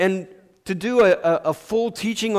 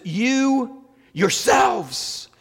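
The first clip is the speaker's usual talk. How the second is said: YOU YOURSELVES stretched slowly at a raised pitch; emphatic; 7 semitones higher, much slower than usual. Retold or imitated. Showing how emphatic Peter is, "You yourselves" high and slow. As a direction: draw out and punch "You yourselves". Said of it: "He's emphatic about this".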